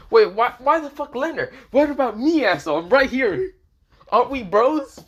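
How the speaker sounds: loud, high-pitched indignant protest; offended outburst. Acted. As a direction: protest loudly at being passed over by a friend. Acted offence at being passed over.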